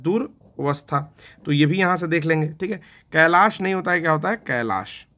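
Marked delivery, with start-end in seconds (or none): 0.0-1.1 दुर्वस्था drawn out slowly and clearly; slow answer demo. Shown as a demonstration. Teacher answering students' question, slow and deliberate.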